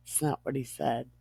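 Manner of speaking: ordinary delivery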